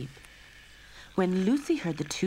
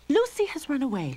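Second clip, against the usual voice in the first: high, louder cross mother; angry; 10 semitones higher, 5 dB louder than usual. A narrator's reading. Mother Lorene upset that Lucy ran off, angry. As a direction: scold about the runaway. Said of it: "Lorene said angrily".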